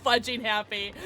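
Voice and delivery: giggling through the words; laughing. Real, not acted. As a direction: laugh while saying happy. Laughing with joy.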